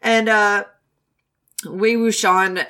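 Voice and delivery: usual speaking voice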